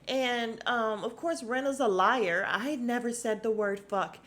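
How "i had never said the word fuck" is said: usual speaking voice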